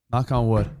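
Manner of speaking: normal talking voice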